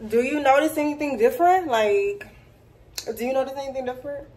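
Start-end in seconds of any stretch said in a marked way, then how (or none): 3.1-4.3 quick, quiet teasing question; whisper-soft tease to viewers; hushed quick tease; soft playful hush. Pretend. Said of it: "teasing viewers".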